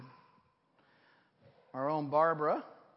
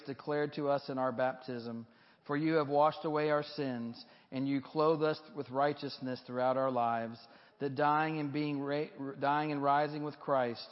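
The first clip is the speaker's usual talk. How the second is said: solemn prayer; 2 semitones higher, 4 dB louder than usual. Acted. Continues the baptismal prayer.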